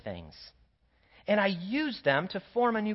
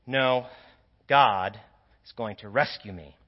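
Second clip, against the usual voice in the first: exhausted; 9 semitones lower than usual. Acted. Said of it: "he says exhaustedly".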